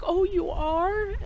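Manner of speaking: high, slow imitated sympathy; concerned mother-in-law. Mother-in-law learns she is sad, high slow imitated concern. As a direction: draw it out high in pitying surprise. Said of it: "trying to console me".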